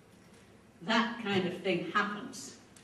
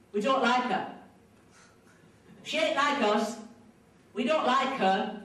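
firm, louder group grievance; imitating disgruntled women; retold complaining; 3 semitones higher, 5 dB louder than usual. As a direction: complain bluntly on behalf of the group. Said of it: "kind of rumbling and mumbling and complaining".

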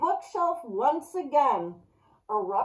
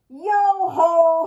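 loud yo-ho; 7 semitones higher, 9 dB louder than usual. A narrator's reading. Pirate tries to cheer up.

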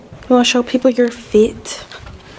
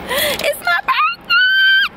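high, drawn-out happy yell; birthday shout; much slower than usual. Real, not acted. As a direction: belt it out, celebrating.

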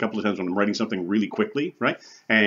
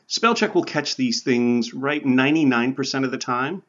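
normal talking voice

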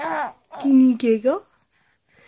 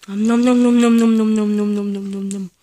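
playful loud chomping noises; nom nom chomping; 5 dB louder than usual. Pretend. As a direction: gobble the toes up, fading out. Parent chomping on baby's toes.

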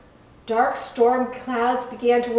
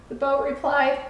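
ordinary delivery, nothing marked

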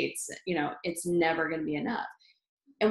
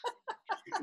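much higher, soft giggle; 9 dB quieter than usual. Real, not acted. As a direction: giggle softly at the memory. Laughing at mom's shock.

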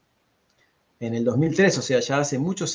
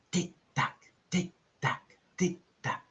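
soft, higher tick-tock; ticking clock; 6 semitones higher, 6 dB quieter, much slower than usual. A narrator's reading. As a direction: tick evenly and quietly like a clock.

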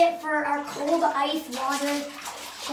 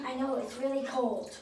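normal talking voice

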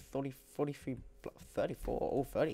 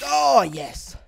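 high, loud cheer; triumphant shout; 12 semitones higher, 13 dB louder than usual. Real, not acted. Gamer delighted with his new sword, triumphant shout. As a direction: burst out in victory.

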